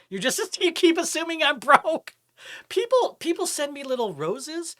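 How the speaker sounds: high, loud, laughing indignation; indignant protest. Real, not acted. Streamer objecting to chat assuming he's broke, indignant.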